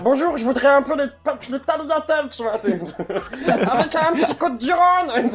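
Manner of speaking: very high squeaky ordering voice; high cartoon voice. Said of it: "parler vraiment que comme ça".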